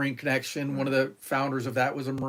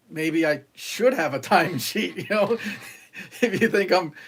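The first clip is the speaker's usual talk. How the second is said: chuckling through the words; laughing; 3 semitones higher than usual. Real, not acted. To the listener, joking about a court-ordered timesheet, laughing.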